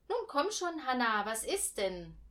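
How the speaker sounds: put-on high, loud, hurrying the child along; urging. A narrator's reading. Mother urging daughter to come along, loud and high. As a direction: push her to come now. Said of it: "drängte Hannahs Mutter".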